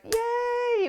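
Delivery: high, drawn-out "Yaaay"; mock cheer. You watching the upload bar race along, high mock cheer.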